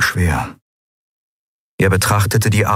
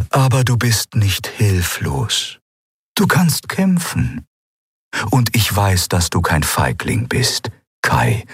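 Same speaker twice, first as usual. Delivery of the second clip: slow, measured encouragement; steady Kai with patient words; calm spirit guide; 3 semitones higher, slower than usual. Acted.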